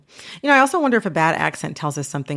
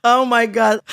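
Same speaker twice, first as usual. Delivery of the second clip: real laughter; 5 semitones higher than usual. Real, not acted. Host teased by colleague about doing accents, laughing hard. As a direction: gasp it out between bursts of laughter.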